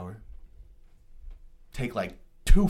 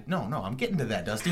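ordinary talk, nothing marked

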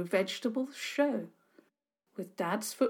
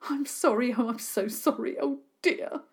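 higher, wobbly tearful voice; tearful apology; 4 semitones higher than usual. Acted. Sarah Milburn apologising to the neighbours, tearful. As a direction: apologise through sniffles. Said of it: "she sniffed".

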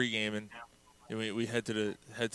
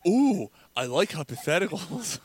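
higher, louder, slow gushing; mock delighted Dave; 6 semitones higher, 5 dB louder, slower than usual. Host mimicking Dave pleased by a hypothetical, higher gushing.

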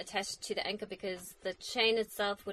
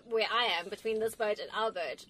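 normal talking voice

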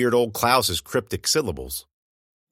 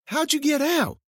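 much higher, startled asking; high puzzled question. A narrator's reading. Narrator finding Klaus loose in the school, startled and high. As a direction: toss it up high in disbelief.

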